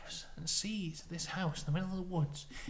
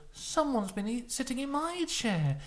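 high-pitched storybook mother bear; Mummy Bear; 7 semitones higher, 3 dB louder than usual. Acted. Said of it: "Mummy Bear goes".

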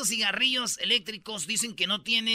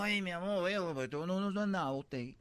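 softer, timid pleading; mild mock plea, backing off; husband meekly begs his drunk wife; husband pleading softly; 3 semitones lower, 8 dB quieter than usual. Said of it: "le dijo".

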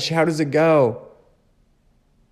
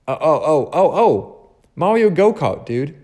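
excited recall; 4 semitones higher, 4 dB louder than usual. Real, not acted. Suddenly remembers game.

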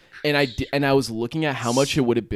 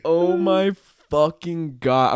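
slow, higher, drawn-out exclamation; exasperated disbelief; 4 semitones higher, much slower than usual. Retold or imitated. Reacting to being ditched, exasperated disbelief. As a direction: stretch it out word by word in retold disbelief.

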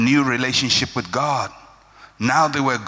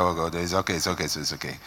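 soothing hush; 11 semitones lower, 6 dB quieter, faster than usual. Retold or imitated. Parents soothing crying baby.

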